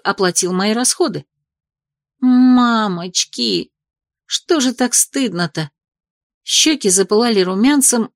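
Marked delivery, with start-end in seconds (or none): 2.2-5.7 embarrassed gasp. A narrator's reading. Embarrassed inner exclamation.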